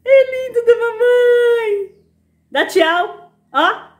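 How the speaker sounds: very high pet-talk coo. Real, not acted. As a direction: coax the cat sweetly, way up high. Cooing cat to wave goodbye.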